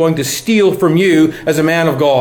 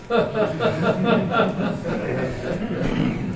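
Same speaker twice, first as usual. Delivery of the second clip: short laugh; 5 semitones higher, 9 dB quieter than usual. Real, not acted. Amused at calling forty old.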